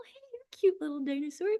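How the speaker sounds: high, quick cutesy voice; playful high-pitched greeting; cooing dinosaur greeting. Retold or imitated. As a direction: coo it high, as if to a cute creature. Acting out cooing at cute dinosaur.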